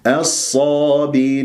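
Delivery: slow tajweed chant, "الصابرين" held long at the end; Quran recitation demo. Shown as a demonstration. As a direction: draw out the final long vowel into a clear ringing noon. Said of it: "واسمع هذه النون المظهرة لما أقف بالمد العارض للسكون ست حركات".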